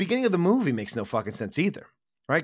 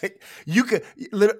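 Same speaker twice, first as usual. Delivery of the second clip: ordinary talk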